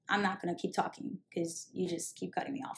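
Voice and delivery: soft, low, fed-up mock refusal; the friend quietly refuses to keep talking; quiet retold refusal. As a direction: lightly voice the friend quietly shutting down. Retells Diane refusing to talk.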